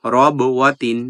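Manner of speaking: রব with the ব bounced out; qalqala bounce. Shown as a demonstration. Teacher demonstrating qalqala on রব. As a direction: jolt the ব of রব ওয়াতিন. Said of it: "একটু ধাক্কা দিয়ে পড়ব".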